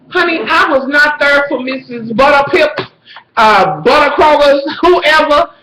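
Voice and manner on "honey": normal talking voice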